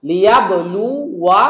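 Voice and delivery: slow, careful লিয়াবলু ওয়া ending on a short ওয়া; slow model recitation. Shown as a demonstration. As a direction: recite লিয়াবলু ওয়া slowly, stop cleanly on ওয়া. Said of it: "দ্বিতীয় শব্দ".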